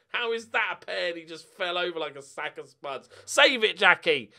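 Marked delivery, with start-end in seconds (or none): none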